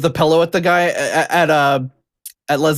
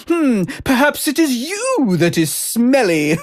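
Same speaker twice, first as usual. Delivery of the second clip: snooty maitre d'; 8 semitones higher than usual. Retold or imitated. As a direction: look down your nose at him. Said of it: "classic snooty maitre d'".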